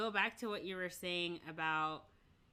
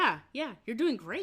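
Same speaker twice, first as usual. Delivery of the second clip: higher, louder gushing praise; mock encouragement; 4 semitones higher, 4 dB louder than usual. Industry people praising a child star, mock encouraging. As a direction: imitate over-keen cheerleading. Acts out empty positive affirmation.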